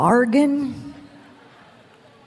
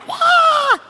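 very high shouted wail; imitated wail; 4 dB louder than usual. Preacher reenacting her screaming on the floor, high wail. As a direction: let out a wild high wail. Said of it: "I'm screaming like".

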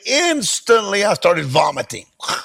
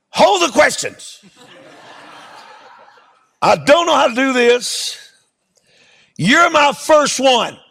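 flustered, pitched up and pressing; half-laughing frustration at his first leper; flustered preacher losing patience, voice up; exasperated; 6 semitones higher than usual. Retold or imitated. Exasperated preacher retelling scene.

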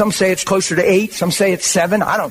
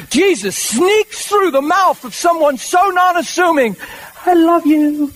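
high, slow, swelling preacher's cry; a preacher building to an emotional peak; preaching fervor; 10 semitones higher, slower than usual. Real, not acted. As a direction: lift it up in rising wonder. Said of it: "preacher building emotion".

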